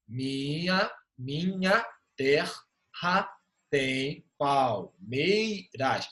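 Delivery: very slow, "Minha terra tem palmeiras" split into separate drawn-out syllables. Shown as a demonstration. Teacher showing students syllable division, "Minha terra tem palmeiras" slowly syllable by syllable. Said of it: "Faz de-, a divisão silábica gramatical".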